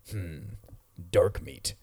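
lightly voiced low villain aside; mimicked villain. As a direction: imitate a movie villain sizing someone up. Retelling villain's line after sizing up.

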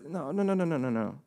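soft, hushing string of refusals; gentle correction. Acted. Pastor voices Jesus answering panicked disciples, soft gentle correction. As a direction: bring the volume down, soothing someone out of panic.

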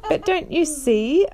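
slow words through a high laugh. Acted. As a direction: still laughing as he speaks. Caspian laughing at ghost idea.

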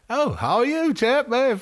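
mock English accent. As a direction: squeak it out like a caricature Englishman. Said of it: "my English interpretation".

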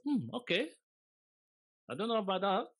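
high sceptical hmm; doubtful. Retold or imitated. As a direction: sound unconvinced, high pitched. Mother's doubtful look.

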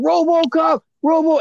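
shouting RoboCop at the actor, half in jest; retold scream. Fan shouting across a Q and A at the actor, mild mock yell. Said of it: "I screamed out".

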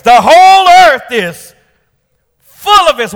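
soaring preacher's shout; shouted holy. Acted. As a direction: proclaim it with full force. Preacher proclaims verse.

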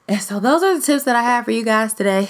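laughing through the sign-off; breaking into a high laugh. Real, not acted. Laughs while wrapping up episode.

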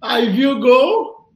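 ordinary talk, nothing marked

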